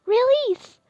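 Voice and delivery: sudden sharp shout of the spell; shouted command. Acted. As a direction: yell the word out high and loud.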